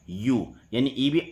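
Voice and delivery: usual speaking voice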